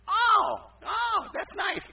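high, loud, drawn-out gushing; mock delight. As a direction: greet it with hammed-up delight. Acts his reply to the mother.

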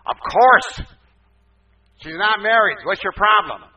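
very loud, high-pitched challenge; hammed-up indignant shout; acted defiant retort. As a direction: throw it back at full volume. Acts his defiant retort to mother.

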